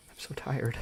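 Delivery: faint whispered sigh of exhaustion; exhausted whisper. Acted. Harmonia cradling herself after panic, whispering exhaustion. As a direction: breathe it out, worn to nothing. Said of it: "she says, cradling herself".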